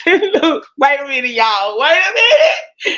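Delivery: high, teary laughter through words; laughing crying. Real, not acted. Telling her audience to wait while laughing, real laugh-cry. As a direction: crack up until it nearly turns to tears.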